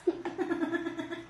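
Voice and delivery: long high-pitched laughing fit; high laughter. Real, not acted. Wife reacts to husband's British demo, high laughter. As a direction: burst into high giggles.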